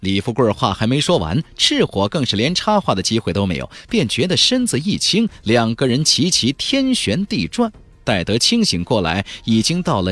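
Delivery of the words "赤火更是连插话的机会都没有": ordinary delivery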